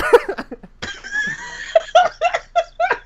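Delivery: high, drawn-out laughter; long laughing fit. Real, not acted. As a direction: lose it laughing after the punchline. Laughs at Roy or Rob line.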